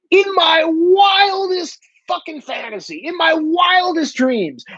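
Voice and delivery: WILDEST stretched out high and slow; emphatic disbelief. Real, not acted. Host swearing he'd never challenge a cop, drawn-out high emphasis on "wildest". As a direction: draw out "wildest" on a high, exasperated pitch.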